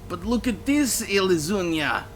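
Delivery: put-on high, pleading drunkard voice; whining drunk. A narrator's reading. Drunk explaining himself to Lisa, high whine.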